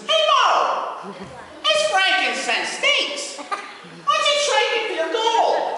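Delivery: very high, loud, whiny Stooge voice; Curly impression. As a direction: whine at Mo like Curly. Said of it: "Curly ... he's all mad".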